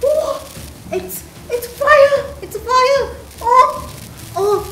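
high, loud excited cry; excited shout. Pretend. Toys watch the rocket blaze and shout excitedly. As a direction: shout with delight at the fire.

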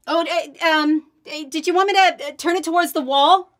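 high, loud, unsure call from afar; from another room. Acted. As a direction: call up from the basement, confused. Calling from the basement.

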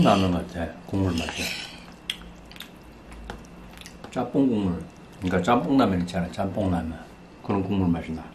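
speaking between bites; slight food in mouth; talking while eating noodles. Real, not acted. Seen 0: Chopsticks in hand, ate noodles at -1.0, leans to bowl again.

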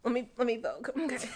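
ordinary delivery

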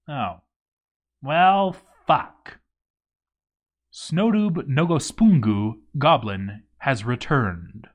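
ordinary delivery

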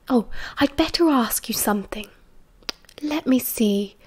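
soft, half-whispered musing; very quiet. A narrator's reading. Missus Potter checks on Remus while others are sleeping, very quiet. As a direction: keep it hushed so nobody wakes. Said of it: "her voice very quiet".

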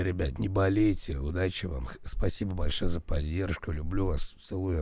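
normal talking voice

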